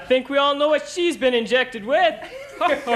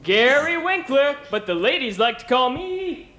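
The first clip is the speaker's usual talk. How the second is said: cheesy game show host, upbeat; sleazy charm, playing to the ladies; flirty teasing dating host.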